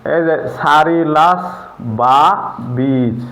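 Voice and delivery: সারিলাস বাবিজ drawn out slowly, sa-ri-las ba-bij; slow spelled reading. Shown as a demonstration. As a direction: read সারিলাস বাবিজ slowly, letter by letter, as a teacher. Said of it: "যেভাবে উচ্চারণ সেভাবে উচ্চারণ করবে".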